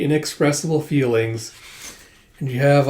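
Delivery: ordinary talk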